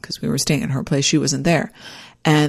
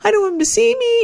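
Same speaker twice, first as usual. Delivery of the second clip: much higher, fluttery panicked voice; mock alarm. Bride in her dress, panicking about the groom seeing her. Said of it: "I'm like".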